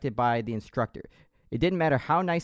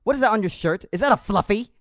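high, loud French Canadian drill sergeant; 8 semitones higher, 5 dB louder than usual. Acted. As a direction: grill the recruit in a French Canadian accent. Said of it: "I wanna hear you attempt it".

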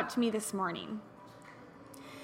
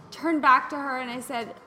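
ordinary delivery

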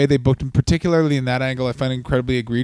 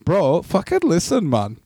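exaggerated Canadian small-town accent; Canadian hoser accent; 3 semitones higher than usual. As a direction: play a rural Ontario bro at the Carp Fair. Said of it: "That's fucking Canadian".

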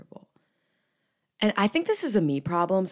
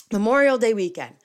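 excited announcement; 5 semitones higher, 9 dB louder than usual. Real, not acted. Announcing the topic.

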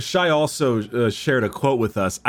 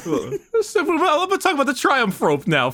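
high, laughing change of subject. Real, not acted. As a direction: talk through laughter, high-pitched. Laughing off teasing about his accent.

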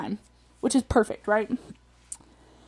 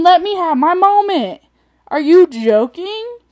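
high, loud indignant protest; exasperated rant; 8 semitones higher, 9 dB louder than usual. Real, not acted. Ranter wanting a moment to vent, loud exasperated protest. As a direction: demand your turn to vent. Ranting at people who defend offenders.

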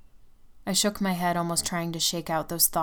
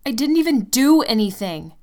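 loud, higher, hurt protest; indignant shout; 6 semitones higher, 9 dB louder than usual. Acted. Girlfriend shouting her innocence at jealous Tim. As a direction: throw the denial back at him.

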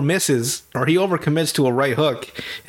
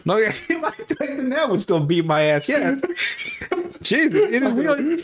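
laughing; 10 semitones higher than usual. Real, not acted. Laughing at Tyson joke.

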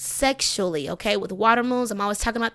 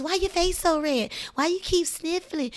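nosy questioner; 6 semitones higher than usual. Retold or imitated. Mocking nosy people at home.

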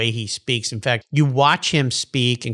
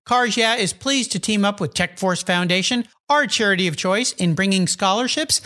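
upbeat charity ad read; 5 semitones higher than usual. Acted. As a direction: read it like a radio spot. Charity ad read over music.

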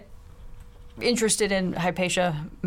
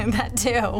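usual speaking voice

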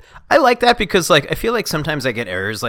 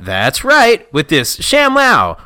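higher, punched-up sales voice; infomercial pitchman; 5 semitones higher, 5 dB louder, much slower than usual. Acted. Pitchman with a headset showing off ShamWow, hammed-up. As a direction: pitch it like a TV huckster.

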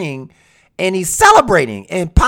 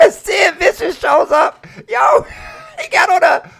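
laughing; 11 semitones higher than usual. Real, not acted. Cracking up at the memory.

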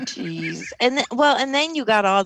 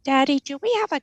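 put-on high, fast child voice; little kid; 6 semitones higher, much faster than usual. Retelling a gun safety ad, a high fast child voice. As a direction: play the kid in the ad. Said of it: "the little kid is like".

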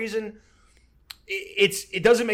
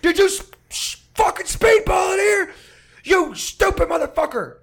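furious yell; 8 dB louder than usual. Retold or imitated. Yelling at his roommate over the speedball, furious and cracking. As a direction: scream it at him, betrayed and furious. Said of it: "got so mad".

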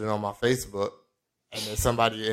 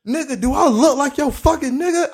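furious sergeant; 6 dB louder than usual. Retold or imitated. Sergeant exploding at recruit.

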